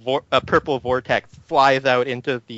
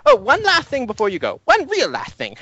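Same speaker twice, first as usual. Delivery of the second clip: very high, fussing send-off; fussy professor; 9 semitones higher than usual. Acted. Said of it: "he says".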